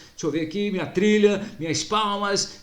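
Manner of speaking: ordinary delivery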